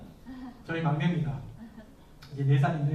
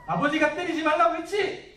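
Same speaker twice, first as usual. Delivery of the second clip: put-on higher, louder scolding of a child; stern rebuke; 8 semitones higher, 4 dB louder than usual. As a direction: scold the little one sharply, voice raised. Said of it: "제가 말로 아주 심하게 책망을 했어요".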